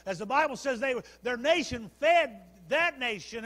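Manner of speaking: loud, high-pitched sermon delivery; rousing pulpit storytelling; full-force preaching. Acted.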